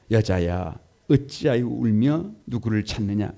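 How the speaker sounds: slow, loud reading of Jesus; Jesus' words read aloud. Acted. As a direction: read the Lord's question slowly and firmly. Congregation reads Jesus' words aloud.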